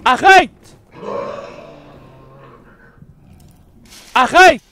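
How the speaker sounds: loud high yells of no; panicked screams. Real, not acted. Player yelling at the attacking monster, panicked screams. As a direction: scream to stop it.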